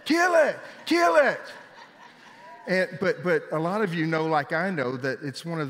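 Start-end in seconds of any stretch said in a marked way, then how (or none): 0.0-1.4 high, loud panicked mock shriek; imitating wife yelling. Preacher imitating his wife Kim shrieking at the snake, high mock panic. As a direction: squeal it like a frightened wife. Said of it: "yelling at me".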